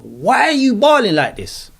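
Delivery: half-amused voice scolding his own tears; scolding himself. Retold or imitated. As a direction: ask yourself in disbelief why you're crying. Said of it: "I'm talking to myself".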